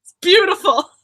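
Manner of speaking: loud, high, over-the-top gushing; sarcastic gushing compliment; a loud, high, fake "It's beautiful"; mock praise. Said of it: "Just kidding".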